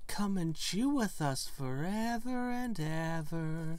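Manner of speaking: slow, eerie sing-song; creepy drawn-out invitation. Acted. Twins luring the stranger forever, slow and eerie. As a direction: stretch it out like a haunting chant.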